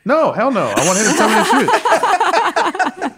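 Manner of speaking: high, slowed laughing insistence. Real, not acted. Telling the hosts he wants the truth from his dad, laughing.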